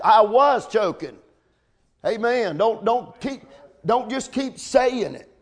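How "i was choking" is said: WAS pressed slow and firm; stress on WAS. Shown as a demonstration. Preacher repeating the lesson, WAS stressed slowly. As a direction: lean on "was", slow and deliberate. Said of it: "Put your choking in past tense".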